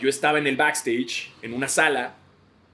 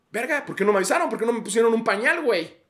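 mock panic; 10 semitones higher, 3 dB louder than usual. Panic on lit stage.

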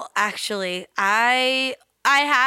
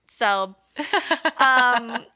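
ordinary talk, nothing marked

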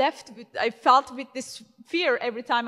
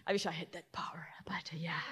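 real whisper, much softer, a quick confiding aside; 5 semitones lower, 11 dB quieter, faster than usual. Real, not acted. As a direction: breathe it as a wistful secret. Wistful aside about father's power.

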